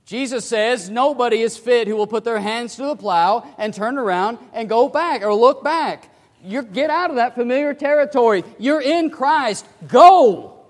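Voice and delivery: loud, high fiery preaching; preacher rising to a loud climax; full force preaching. Acted. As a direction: drive it home to the congregation.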